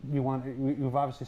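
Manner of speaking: muffled behind a hand; talking into your hand; hand over mouth. Real, not acted. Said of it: "took my hand off my face".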